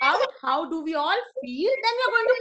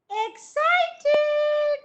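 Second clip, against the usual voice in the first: excited; 8 semitones higher than usual. Shown as a demonstration. Teacher shows the excited card.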